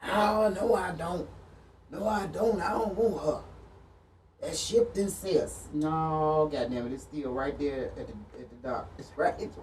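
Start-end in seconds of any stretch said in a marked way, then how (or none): 0.0-5.7 mocking ex's denial. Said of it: "I said".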